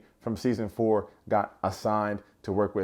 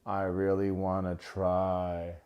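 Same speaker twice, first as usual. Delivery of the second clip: slow, softer hypnotized murmur; Jimmy under the snake's spell, slow and dazed; entranced; 3 semitones lower, 5 dB quieter, much slower than usual. Retold or imitated. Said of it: "Jimmy's like".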